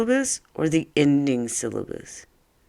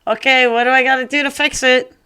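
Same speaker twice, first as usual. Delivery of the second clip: eager question; 10 semitones higher, 5 dB louder, faster than usual. Acted. Quiz question to kids.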